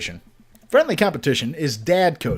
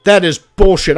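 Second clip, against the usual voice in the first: a louder, indignant "That is bullshit"; louder outburst; 3 semitones higher, 9 dB louder than usual. Real, not acted. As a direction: blurt it out louder in protest. Annoyed at the lucky catch.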